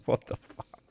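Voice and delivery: whispered, laughing what the fuck; breathless laugh of shock; stunned, laughing whisper; laughing disbelief. Real, not acted. Stunned reaction to the story.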